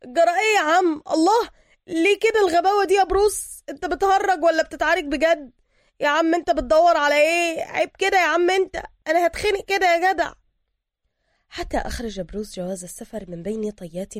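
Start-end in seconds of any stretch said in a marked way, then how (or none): 0.0-10.3 high, loud yell of alarm; screaming in protest. A narrator's reading. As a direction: yell indignantly while being pinned by the throat. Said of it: "وسوكا يصرخ".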